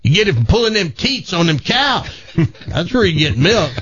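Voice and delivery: high-pitched country farmer, tickled; laughing farmer. Acted. Farmer explaining milk to the city boy, laughing. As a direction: hammer it home as an amused farmer. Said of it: "And the farmer starts laughing again".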